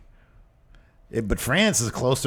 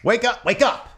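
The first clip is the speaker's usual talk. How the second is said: mock wake-up call; 9 semitones higher than usual. Calling out to a sleepy interviewer.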